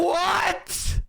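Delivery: high, disbelieving cry; incredulous what. Real, not acted. Streamer hearing the pundit's claim, shocked high cry.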